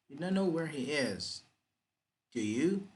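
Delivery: "do you" rising at the end as a real question; rising intonation on tag. Shown as a demonstration. Teacher shows learners a real question, rising intonation on "do you". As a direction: lift the pitch on "do you?". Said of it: "You rise".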